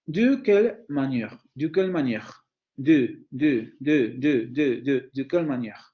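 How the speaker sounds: "de" with its schwa fully sounded; teacher's demo, keeping the vowel in "de"; first-syllable vowel pronounced. Shown as a demonstration. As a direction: say "de" as a full syllable before "quelle manière". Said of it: "entonces la voy a pronunciar".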